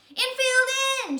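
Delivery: high, loud, slow call; loud stretched field call; shouted. Acted. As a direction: yell it across the field. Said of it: "shouted Damien".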